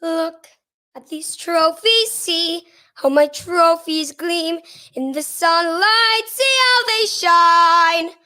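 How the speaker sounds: Trunchbull solo, sung in parts; Miss Trunchbull. Acted. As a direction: belt out the headmistress's proud boast. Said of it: "this is one of her solos".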